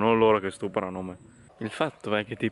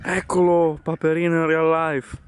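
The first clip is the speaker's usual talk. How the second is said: excited; 6 semitones higher, 5 dB louder than usual. Real, not acted. Excited spotting.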